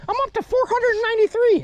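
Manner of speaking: mock score-keeper's very high voice; Jeff Courier. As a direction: jokingly call out a tally in a squeaky-high pitch. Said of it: "keeping score like Jeff Courier".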